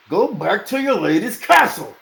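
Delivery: high, loud order; shouting. A narrator's reading. Dunk shouting the diggers away, high and loud. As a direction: drive them off with a shout.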